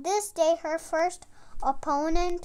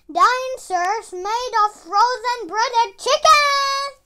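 dramatic reveal; 6 semitones higher, 8 dB louder than usual. Acted. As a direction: unveil the monster with drama.

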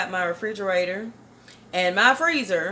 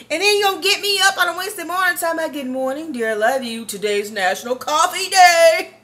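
fast, high, loud complaining to mama, ending in a squealing imitation; mock exasperated rant; 8 semitones higher, 7 dB louder than usual. Daughter ranting back at her mom about coffee day. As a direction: rattle it off then mimic her. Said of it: "that's the kind of mama I got".

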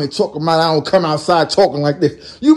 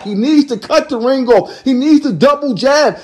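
ordinary talk

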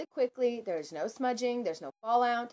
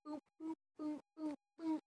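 playful boops; 7 semitones higher, 11 dB quieter than usual. Pretend. Playful sign-off noises.

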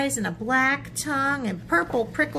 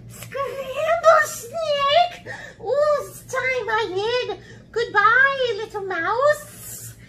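high, loud, drawn-out cry of alarm; panicked snake; 6 dB louder, much slower than usual. Acted. Snake fleeing after hearing the menu, high loud panic. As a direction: stretch the words out in high-pitched fright. Snake frightened of being eaten.

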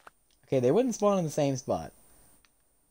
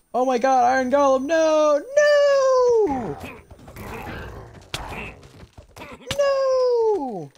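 rising anguished cries of no, louder and much higher; anguished shout; 14 semitones higher, 6 dB louder, much slower than usual. Real, not acted. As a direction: scream it as your friend goes down, real despair. Golem dies in combat.

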